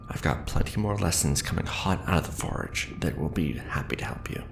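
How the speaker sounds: ordinary talk, nothing marked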